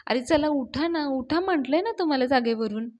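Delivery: forceful acted nagging; insistent urging. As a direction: push them out of bed, loud and insistent. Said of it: "त्यांना force करतेच".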